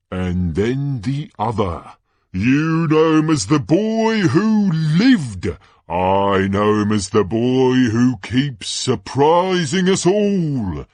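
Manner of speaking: high, drawn-out showman's build-up; Ludo Bagman, suspense-building announcer. Acted. Announcer teasing the second Hogwarts champion, showy and loud. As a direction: build suspense before the big name.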